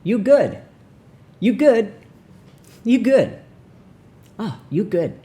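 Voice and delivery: high, loud, punchy mock greeting; walking-around greeter. Passer-by greeting everyone, in a high hammed-up call. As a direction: call it out high, easing off on the last. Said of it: "imagine walking around going".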